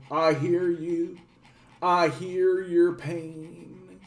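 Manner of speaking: slow, soft, syrupy politician voice; oozing fake sympathy. Streamer mocks politicians pretending to feel voters' pain, slow syrupy sympathy.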